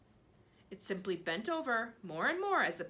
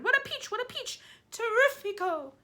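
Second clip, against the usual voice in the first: excited; 8 dB louder than usual. Acted. Aunts cheering the peach.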